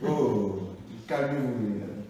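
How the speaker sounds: usual speaking voice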